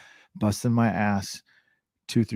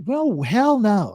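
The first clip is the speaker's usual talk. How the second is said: high, slow drawn-out no way; wife's flat refusal; 12 semitones higher, 4 dB louder, much slower than usual. Retold or imitated. Wife hearing the Mac's price, high slow mock refusal.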